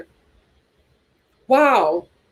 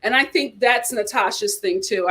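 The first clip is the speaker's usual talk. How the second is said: ordinary talk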